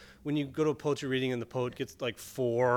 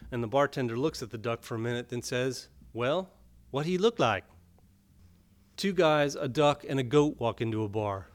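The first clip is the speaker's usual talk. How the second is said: measured, even poetry reading voice; poem reading; 5 dB louder than usual. A narrator's reading. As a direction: read it like a poet at a reading, unhurried. Poet reads poem aloud.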